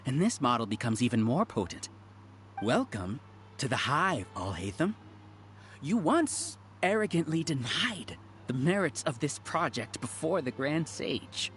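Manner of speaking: usual speaking voice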